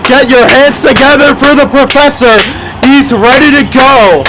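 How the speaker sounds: amped-up arena play-by-play; sports commentary. Acted. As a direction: call it like a live announcer. Live play-by-play over show footage.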